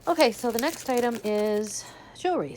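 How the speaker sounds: usual speaking voice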